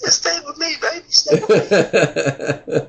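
high, drawn-out begging; pleading Curry character. Retold or imitated. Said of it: "I love this character".

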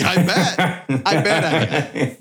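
laughing smugly. Retold or imitated. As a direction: chuckle it out, pleased. Smug reply to dentist.

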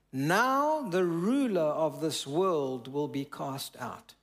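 "Now" drawn out on a swelling pitch; stress on NOW. Retold or imitated. Preacher quoting Jesus on the world's ruler, "Now" heavily stretched.

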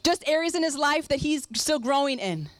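ordinary delivery

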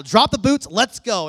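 loud, pushy command; urgent. Retold or imitated. Husband hurrying his wife out of the mall, loud and urgent. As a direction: bark it at your wife, no arguing. Urging wife to leave now.

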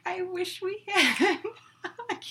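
giggling through the words; breaking up laughing after a sip. Real, not acted. Laughing after tasting strong drink.

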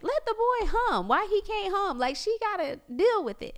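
high teasing impression of husband; imitating Anthony. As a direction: mimic him defending the boy's humming. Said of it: "And then Anthony be like".

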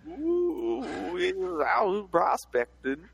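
put-on high slow old-timey miner drawl; hammed-up cowboy prospector voice. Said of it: "old-timey prospectors".

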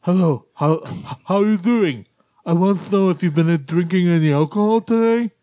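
mock police officer with muffled, deaf-speech articulation; put-on slurred-consonant officer voice; Lou Ferrigno. Officer Ferrigno questioning a driver about drinking, impersonated. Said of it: "That's my Lou Ferrigno impersonation".

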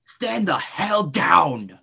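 slow furious shout. Acted. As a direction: yell it, dragging each word. Armed standoff, lawman yelling.

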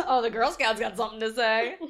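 loud, laughing mock taunt; laughing tease. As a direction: tease loudly through laughter. Laughing, repeats an old teasing roast.

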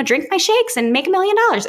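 high, hammed-up mock of a cheesy seller; mocking sales pitch. Mocking network marketing posts she refuses to copy, high sarcastic pitch. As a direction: parody the salesy social media pitch. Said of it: "Like, you know".

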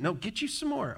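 high, soft insistent grandma voice; lightly voiced Southern grandma pressing food. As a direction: playfully mimic a pushy grandmother offering seconds. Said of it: "Ginger's grandma was that way".

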